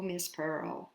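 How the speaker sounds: ordinary delivery, nothing marked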